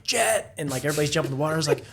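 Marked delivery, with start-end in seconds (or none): 0.0-0.5 higher, urgent shout of warning; retold alarmed cry of jet. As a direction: yell it as a panicked warning. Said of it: "I'm like".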